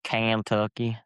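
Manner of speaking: Kentucky twang on the name; mock Kentucky accent. Friend teasing another about their roots, mock Kentucky accent. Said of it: "mixed with the Kentucky accent".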